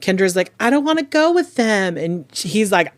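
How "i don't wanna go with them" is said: high mimicked whine; mock whining protest. Mimicking the mother refusing to go with parents, mock whine. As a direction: complain it high like a reluctant kid.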